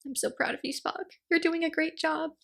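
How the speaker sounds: soft, high and tender, almost hushed; lightly voiced doting mother; proud mother. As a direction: beam at your son in a soft high coo. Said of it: "just looking at him like".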